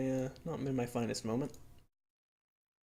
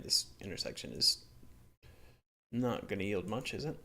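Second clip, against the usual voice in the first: usual speaking voice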